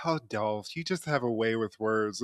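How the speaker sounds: soft teasing whisper; whispered aside. Real, not acted. Host teasing the character under his breath, a soft whisper. Mocking aside about Dolph.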